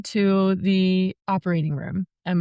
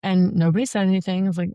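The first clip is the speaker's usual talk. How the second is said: ordinary delivery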